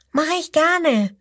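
high, puzzled little croc voice; little crocodile asking. Acted. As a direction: ask innocently in a tiny high voice. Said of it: "sagt das kleine Krokodil".

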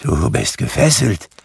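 understated frightened remark; a touch of trembling dread. A narrator's reading. Scrooge seeing the ghost's chains, mildly trembling. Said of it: "sagte Scrooge zitternd".

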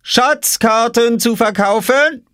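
slow, high street-seller's cry; calling out. A narrator's reading. Oskar hawks maps from behind his crate, calling out. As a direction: hawk it like a market vendor. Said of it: "rief Oskar hinter seiner Obstkiste".

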